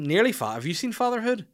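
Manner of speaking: usual speaking voice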